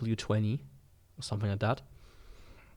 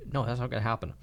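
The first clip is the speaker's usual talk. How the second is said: usual speaking voice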